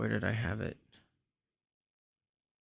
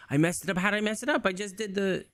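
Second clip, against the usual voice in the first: dismayed; 10 semitones higher, faster than usual. Real, not acted. Settings broke, dismayed.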